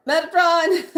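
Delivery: high, loud cry of the name; startled, delighted shout; excited exclaim. Real, not acted. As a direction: call the name out, thrilled. Exclaiming card name, overwhelmed.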